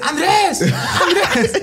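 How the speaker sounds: high-pitched name call breaking into laughter; pundit laughing through the name. Retold or imitated. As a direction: imitate the partner cracking up on air. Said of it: "y el Puma dice".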